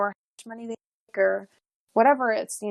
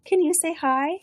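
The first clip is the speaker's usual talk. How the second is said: higher, sing-song voice to get a little one to say hello; 7 semitones higher than usual. Real, not acted. Mother coaxing her daughter to greet the guest, sing-song.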